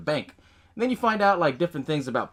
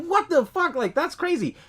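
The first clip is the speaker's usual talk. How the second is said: disbelief; 14 semitones higher than usual. Retold or imitated. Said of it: "I was like".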